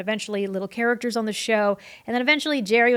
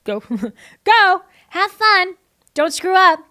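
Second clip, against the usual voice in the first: high, loud rapid-fire orders; brisk, raised-voice send-off; mock send-off; 9 semitones higher, 9 dB louder than usual. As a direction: hustle them out with punchy cheer. Mimics being sent on set.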